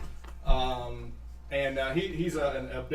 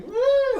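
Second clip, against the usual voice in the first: very high, loud whoop; tossed gnome whooping as she spins; high woo; 8 dB louder than usual. Acted. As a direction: whoop it out wildly. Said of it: "was like".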